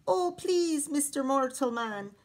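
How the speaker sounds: high wheedling plea; pleading. Acted. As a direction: beg the big man sweetly. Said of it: "he pleaded".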